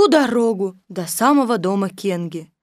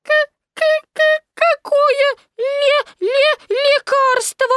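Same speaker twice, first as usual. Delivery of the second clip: very high, slow frightened stutter; faltering stammer; 4 dB louder, slower than usual. A narrator's reading. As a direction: stammer on each first syllable in a squeak. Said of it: "пролепетал Пятачок". (испуганный писклявый лепет с заиканием)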